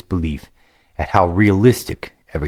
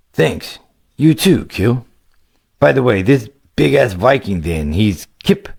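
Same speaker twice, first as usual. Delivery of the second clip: put-on lightly raised, eager young gamer voice; a casual young player, a bit higher than the narration; upbeat young adventurer, higher pitched; young hero Greylick; 6 semitones higher than usual.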